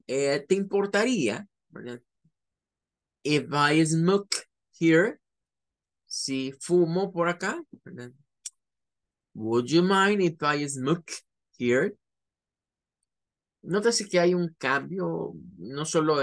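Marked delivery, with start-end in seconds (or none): 3.2-5.2 If I smoke here, slow and clear; slow model phrase. Shown as a demonstration. Teacher models the sentence slowly for the class. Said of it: "model phrase". 9.3-12.0 Would you mind if I smoke here, slowly with rising end; slow polite model. Shown as a demonstration. Teacher models the polite request slowly. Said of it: "model phrase".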